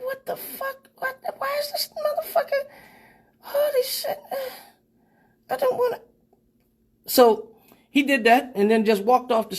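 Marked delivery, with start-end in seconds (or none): 0.0-6.0 very high, softer, laughing what the fuck; appalled laughing disbelief. Retold or imitated. We in the crowd watching him, high appalled laughing squeal. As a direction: squeal through the words in horrified glee.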